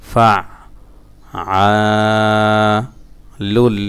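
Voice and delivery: ফা, আ, লুল, লি one at a time; broken-up syllables. Shown as a demonstration. Teacher repeating the word for learners, halting chant. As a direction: hold আ long and pause between syllables. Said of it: "ভাঙা ভাঙা উচ্চারণ".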